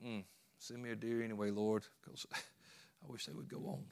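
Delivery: soft, resigned mumble to the Lord, chuckling; eye-roll prayer. Retold or imitated. Hunter prays under his breath as neighbours ride by, resigned. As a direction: sigh it out with a laugh, resigned. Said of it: "roll my eyes and go like".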